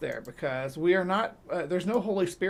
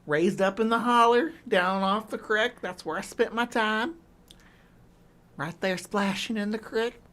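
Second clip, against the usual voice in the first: playful backwoods Southern gal; hammed-up rural Southern accent; 4 semitones higher than usual. Shown as a demonstration. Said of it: "I sound like a very backwoods kind of gal".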